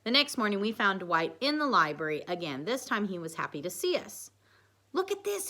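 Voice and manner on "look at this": mild shout, pitched way up; excited shout. A narrator's reading. Dwight showing off the chapstick, high excited call. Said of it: "he shouted".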